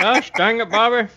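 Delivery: lightly drawled Texan dad scolding; a hint of exasperated Hank Hill. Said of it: "I'm Hank Hill and you, you're Bobby".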